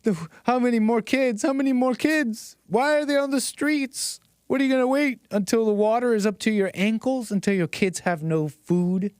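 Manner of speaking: high, overwrought sob-story pleading, hammed up; mock crying plea. Commentator mocking the activist's tearful plea, high and overwrought. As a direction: ham up a fake tearful plea. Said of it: "Anybody convinced by this crying?".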